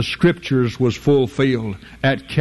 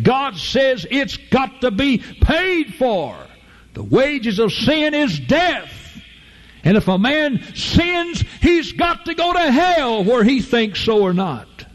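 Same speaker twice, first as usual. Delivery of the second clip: much higher, slow and then shouted sermon; full-force preaching; 10 semitones higher, slower than usual. Acted. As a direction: bring down judgment from the pulpit.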